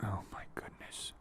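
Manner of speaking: soft dismayed whisper. Pretend. Passenger hears the dog got sugar, horrified whisper. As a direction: gasp it out under your breath.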